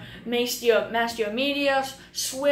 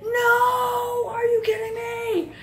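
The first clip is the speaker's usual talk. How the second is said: no cried out very high, breaking into a shout; anguished cry; 12 semitones higher, 3 dB louder, slower than usual. Retold or imitated. Michael seeing Adam shot, anguished high cry. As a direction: scream it as your friend gets shot.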